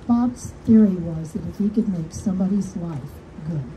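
normal talking voice

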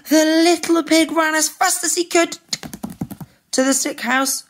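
high, breathless-style chase narration; high-pitched alarm; urgent storytelling. A narrator's reading. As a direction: narrate the escape in a panic.

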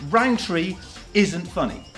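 ordinary delivery

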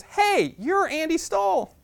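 high, bright cheerleader greeting; high girly voice. Retold or imitated. Cheerleader captain spotting the shy boy, high chirpy greeting. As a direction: play the popular girl, sweetly.

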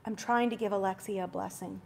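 quiet, low inner voice of the Spirit; hushed, steady inner prompting. Retold or imitated. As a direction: speak it softly, like a voice within. Holy Spirit speaking inside him.

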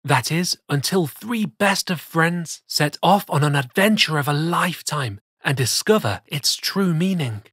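ordinary delivery, nothing marked

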